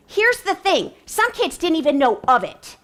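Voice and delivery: ordinary talk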